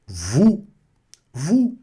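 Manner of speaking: "Vous" as voo, no final s; silent S. Shown as a demonstration. Teacher modelling for students, "Vous" with silent S. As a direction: end "Vous" on a pure oo. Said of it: "Não pronuncia esse S".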